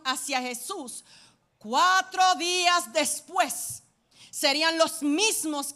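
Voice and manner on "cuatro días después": louder, slowed way down; dramatic slow emphasis. Real, not acted. Preacher stressing the turn of the crowd, slow and heavy. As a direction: lean on each word for weight.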